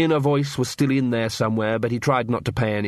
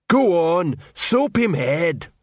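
slow, heavy troll voice; 6 semitones higher, much slower than usual. A narrator's reading. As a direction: drag the words like a slow troll. Troll urging Cuddy, slow.

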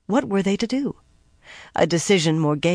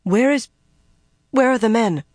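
halting, then raised in worry; higher, uneasy, false start; anxious question; 6 semitones higher, 4 dB louder than usual. A narrator's reading. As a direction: break off and ask anxiously, pitch up. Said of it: "looking around the room anxiously".

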